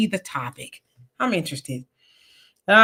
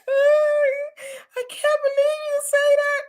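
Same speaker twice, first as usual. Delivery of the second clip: much higher wailing voice, hammed-up tears; mock crying old lady; slower than usual. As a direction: play the hurt substitute teacher sobbing it out, overdone. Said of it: "she started crying".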